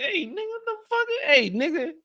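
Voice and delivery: high-pitched pretend indignation; mock outrage. Imagining a stranger at the meeting, mock outraged. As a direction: yelp it high like someone crossed a line. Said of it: "I'm like".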